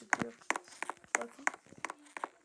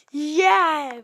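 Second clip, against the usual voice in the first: loud triumphant yell; victory shout; 3 semitones higher, 15 dB louder than usual. Real, not acted. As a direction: cheer the win. Victory cheer.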